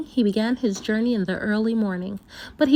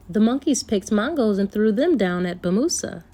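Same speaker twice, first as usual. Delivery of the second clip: ordinary delivery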